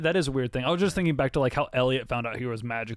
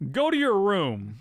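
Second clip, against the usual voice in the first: raised, higher-pitched mock parental order; scolding parent; 6 semitones higher than usual. [name] taking his turn as parent, mock-stern scolding. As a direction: send the child away with hammed-up authority. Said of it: "We got to be parents".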